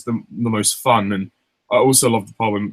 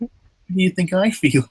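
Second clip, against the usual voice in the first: higher, slow put-on voice; cartoon retort; 8 semitones higher than usual. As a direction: draw it out in character. Quoting with friend.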